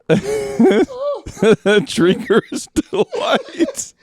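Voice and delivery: words drawn out through laughter; helpless laughter. Real, not acted. Host repeating the drinker's delight trophy line, cracking up. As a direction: squeeze the words out between laughs.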